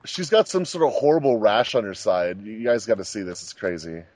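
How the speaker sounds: normal talking voice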